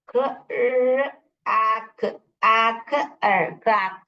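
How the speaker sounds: क्रैक broken into very slow separate sounds, "k-rr-aak"; syllable sounding-out. Shown as a demonstration. Teacher showing where the word divides, sounded out. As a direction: drag out each sound of क्रैक. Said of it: "यहाँ पर divide होगा".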